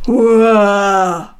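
loud, drawn-out "Hurra" from far off; a big cheer from outdoors; cheer from outside. A narrator's reading. Animal cheers outside before entering, loud distant cheer. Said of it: "machte es draußen".

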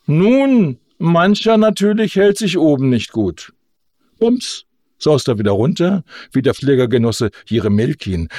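folksy agitator making a speech; orator starting anew. A narrator's reading. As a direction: explain flying to the peasants in simple words.

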